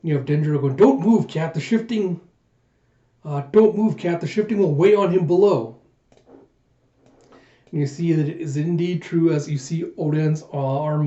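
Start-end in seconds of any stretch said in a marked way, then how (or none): 0.8-5.8 urgent warning. Retold or imitated. Vassal warns comrade to keep still.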